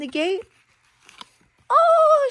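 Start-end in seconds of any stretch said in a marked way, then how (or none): 1.7-2.3 high, loud mock-surprised oh. Mother revealing the sheep, hammed-up surprise. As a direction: pop the surprise for the child.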